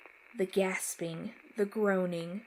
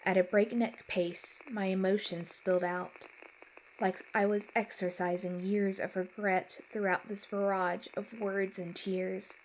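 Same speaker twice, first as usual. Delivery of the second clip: ordinary delivery, nothing marked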